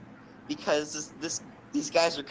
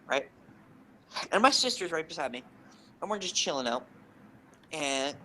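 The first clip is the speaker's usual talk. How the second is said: normal talking voice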